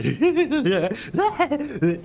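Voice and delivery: high, gleeful evil cackle; villain laugh through a helmet. Shown as a demonstration. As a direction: cackle like a trooper raining fire from above. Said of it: "you can hear him laughing through his helmet like that".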